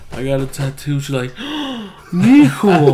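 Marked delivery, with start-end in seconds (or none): 1.4-1.9 mock horrified intake of breath; sharp, hammed-up gasp; shocked gasp. Mom's reaction to tattoo confession. 2.1-2.9 loud, high scandalized cry; hammed-up dismay; mock motherly shock. Mom shocked at tattoo news.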